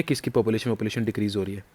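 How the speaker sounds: ordinary talk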